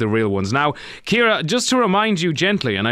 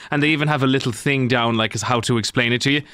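ordinary talk, nothing marked

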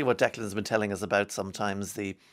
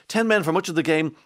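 usual speaking voice

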